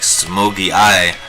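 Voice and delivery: ordinary talk, nothing marked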